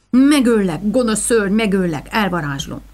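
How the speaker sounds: loud growled threat, spoken through clenched teeth; a fierce vow through gritted teeth; threatening through teeth. Acted. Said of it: "ropogtattam a fogaim között". (fogcsikorgatva fenyegetőzve)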